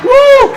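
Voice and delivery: very high, loud "woo"; high celebratory hoot; excited whoop. Acted. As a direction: let out one piercing party whoop. Whooping while dancing.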